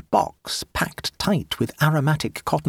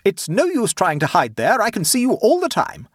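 high, loud scolding call; angry calling; 9 semitones higher, 4 dB louder than usual. A narrator's reading. Aunt shouting at the hidden boy, angry call. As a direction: scold him from across the garden.

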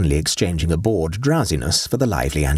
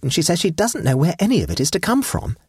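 put-on high, light young girl's voice; girlish Vera voice; 14 semitones higher than usual. Vera tells her aunt about Betsy, girlish voice. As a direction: lift into a teenage girl.

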